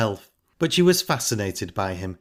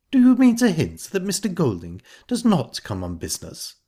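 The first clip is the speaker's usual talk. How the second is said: higher-pitched indignant challenge; angry; 8 semitones higher, 4 dB louder than usual. A narrator's reading. Missus Bryce challenging Ida's hint about Golding, angrily. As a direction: demand it with mild anger. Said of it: "said Missus Bryce angrily".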